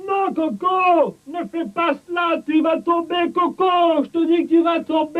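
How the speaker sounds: high, worried shouts; anxious warning. Acted. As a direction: plead with him to stop. Friend warns Coco, voice raised.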